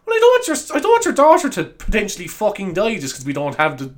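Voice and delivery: high, exasperated mock protest; acted indignant outburst; exasperated inner voice. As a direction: protest in disbelief, starting high. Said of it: "in my head, I was like".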